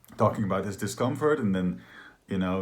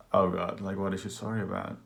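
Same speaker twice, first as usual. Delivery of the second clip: usual speaking voice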